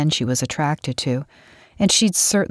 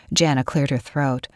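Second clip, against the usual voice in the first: ordinary delivery, nothing marked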